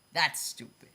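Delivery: ordinary talk, nothing marked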